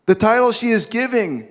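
ordinary delivery

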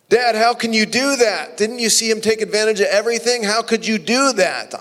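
the resentful brother pleading with Dad; indignant older son. Acted.

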